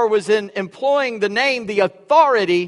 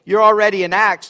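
ordinary delivery

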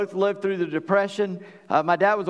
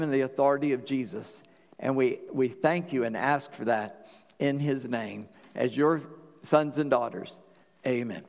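pastor praying over the congregation, hushed and earnest; prayer; 4 semitones lower than usual. Acted.

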